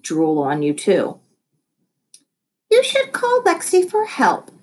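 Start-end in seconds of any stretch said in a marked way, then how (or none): 2.7-4.5 high, bright teacher voice; Miss Corgi. A narrator's reading. Teacher recommending Lexi to Noah, high sweet voice. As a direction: voice the cheery teacher, pitched way up. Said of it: "suggested Miss Corgi".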